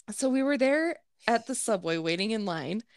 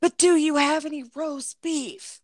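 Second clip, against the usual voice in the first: higher mock-demanding customer voice; pushy customer; 5 semitones higher, 3 dB louder than usual. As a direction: imitate a customer loudly insisting. Retold pushy yelling customer persists.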